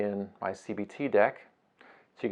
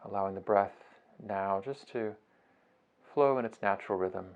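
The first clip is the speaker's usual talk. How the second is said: soft, unhurried meditation voice with long pauses; guided meditation; 7 dB quieter than usual. Acted. Teacher guiding listeners through a beach breathing meditation, soft and unhurried. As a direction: ease them gently through each breath, phrase by phrase.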